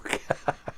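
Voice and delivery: soft, breath-only laugh; short chuckle. Real, not acted. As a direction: chuckle quietly at your own story. Chuckles at own story.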